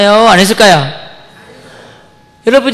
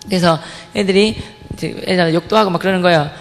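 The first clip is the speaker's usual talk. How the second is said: ordinary delivery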